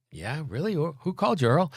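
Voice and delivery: playing dumb, asking lightly; a touch of mock surprise; feigned innocence. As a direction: pretend you have no idea. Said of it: "act like I don't".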